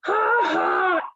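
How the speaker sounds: put-on high, gleeful little-girl laugh; mocking laugh. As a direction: laugh at her brother's spill. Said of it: "Anna laughed".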